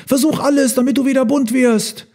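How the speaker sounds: high, urgent elephant plea; calling out. A narrator's reading. Herd urges Elmar to turn colourful, calling out high. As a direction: urge him high and eagerly. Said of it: "riefen die Elefanten".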